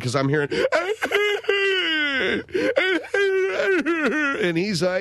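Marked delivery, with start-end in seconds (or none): 0.5-4.4 imitated bawling wail. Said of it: "he's bawling".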